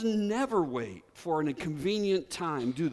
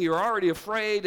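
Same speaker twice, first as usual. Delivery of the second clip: ordinary talk, nothing marked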